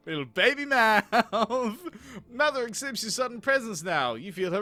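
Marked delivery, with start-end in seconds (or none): 0.1-1.8 slow, louder, laughter-filled drawl; laughing mock repeat. Player amused by the baby's description, laughing mock repeat. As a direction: draw it out through laughter.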